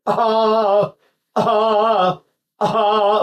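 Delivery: high, short open "ah" bursts; demonstrated infant cry on "ah"; baby cough-cry. Shown as a demonstration. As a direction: imitate a crying baby's cough. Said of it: "cough the way a baby does".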